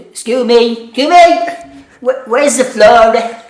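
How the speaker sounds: high, loud, slow Dublin woman; thick Dublin accent, slow and high; Dublin woman's accent. Retold or imitated. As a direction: ham up a thick Dublin customer asking. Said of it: "this Dublin woman".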